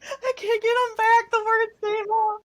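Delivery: mock wail. Host voicing regret dramatically.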